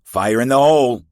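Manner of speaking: shouted warning. Acted. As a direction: warn everyone at full voice. Throwing an explosive in battle.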